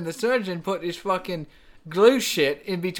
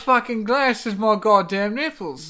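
mock British; 6 semitones higher, 6 dB louder than usual. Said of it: "we're impersonating you guys".

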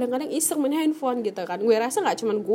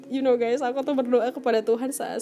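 ordinary delivery

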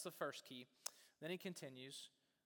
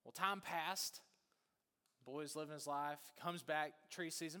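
ordinary talk, nothing marked

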